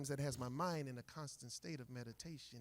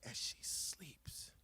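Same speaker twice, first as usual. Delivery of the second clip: hushed unvoiced whisper; 2 semitones lower, slower than usual. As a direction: whisper so as not to wake her. Poem about watching her sleep.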